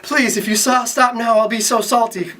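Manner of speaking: high, hammed-up begging; pleading cry. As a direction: cry out a mock-desperate plea. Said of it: "Duke Corey cried".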